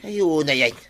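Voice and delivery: loud frustrated complaint; exasperated outburst. Acted. Finds no water either. (nakaunget a napigsa)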